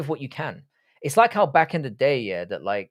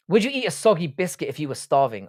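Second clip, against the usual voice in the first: normal talking voice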